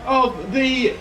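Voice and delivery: sports commentator riding a tight finish; live race commentary. Acted. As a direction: call the final lap, stretching words as tension builds. Live last-lap race commentary.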